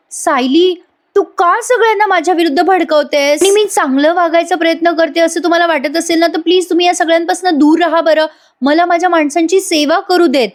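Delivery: high, pleading melodrama; dramatic Priya. Retold or imitated. Narrator voicing Priya's pleading drama to the family. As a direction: play up the hurt innocence. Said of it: "Priya full on drama करते".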